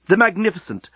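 ordinary delivery

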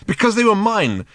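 high, indignant retort; cross. A narrator's reading. Ford defending his lost shoes to Arthur, cross. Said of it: "said Ford crossly".

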